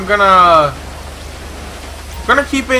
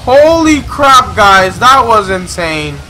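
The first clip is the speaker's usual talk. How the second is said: stunned excitement; 3 semitones higher, 4 dB louder, slower than usual. Real, not acted. Gamer to viewers after a gunfight, stunned excitement. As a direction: exclaim to viewers once the danger is over.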